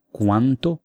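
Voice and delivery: usual speaking voice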